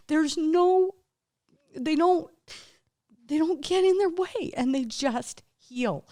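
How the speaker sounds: fighting back real tears; near tears. Real, not acted. Moved to tears telling how animals simply heal, tearful and high. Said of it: "I'm gonna cry about this".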